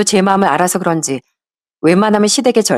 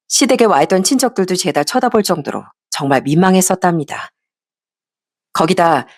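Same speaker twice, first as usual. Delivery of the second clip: plain reading voice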